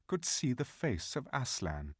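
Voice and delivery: ordinary delivery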